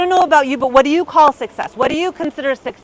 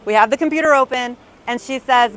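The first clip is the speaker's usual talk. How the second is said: ordinary delivery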